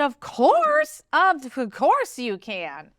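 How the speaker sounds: beam and agree eagerly; excited agreement. Retold or imitated. Coach answers her coach's request to record, thrilled. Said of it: "because I'm all excited".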